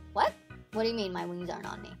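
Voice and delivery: low, fast, baffled hero; Buzz Lightyear. Acted. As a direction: question it quickly in a low voice. Buzz baffled about missing wings.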